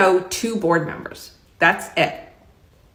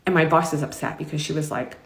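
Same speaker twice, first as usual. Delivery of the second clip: usual speaking voice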